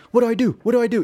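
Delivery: high, keen imitation of a newcomer; eager new recruit. Brand new soldier asking for orders, imitated eagerly. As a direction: ask it high and urgent, waiting for orders.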